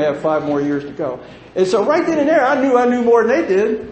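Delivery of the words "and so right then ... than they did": high, loud, gleeful realisation; I knew better all along; triumphant. Real, not acted. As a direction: crow it out, much higher and louder.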